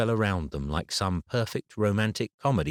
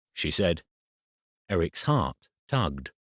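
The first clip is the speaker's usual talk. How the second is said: usual speaking voice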